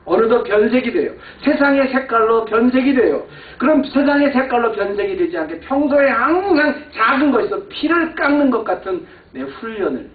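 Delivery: impassioned sermon climax; fiery preaching. Acted. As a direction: drive the point home to the congregation. Preaching at full force.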